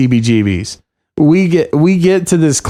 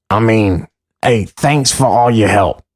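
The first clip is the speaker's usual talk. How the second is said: impression of Joe; 4 semitones lower than usual. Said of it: "a pretty good impression of you".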